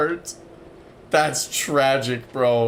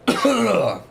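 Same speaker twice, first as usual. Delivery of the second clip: choking splutter on water; coughing up water; 8 semitones higher than usual. Real, not acted. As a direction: splutter like water went down wrong. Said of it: "water went down the, the wrong pipe there".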